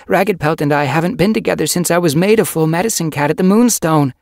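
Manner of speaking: loud, high, insistent self-defence; protest. A narrator's reading. Yellowfang defending herself to Sage Whisker, protesting loudly. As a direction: defend yourself at high pitch and full voice. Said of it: "Yellowfang protested".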